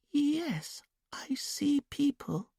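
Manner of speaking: high, slow, whispered dreamy voice; trance whisper. A narrator's reading. Julia in trance answering Dominic, high childlike whisper. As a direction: breathe it out far away, like a child.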